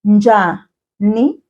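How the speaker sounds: "Unjani" slowly, split into separate syllables. Shown as a demonstration. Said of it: "Repeat after me".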